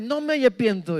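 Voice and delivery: put-on a boy refusing to repent; stubborn child. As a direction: dig in your heels like a small boy. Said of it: "decía".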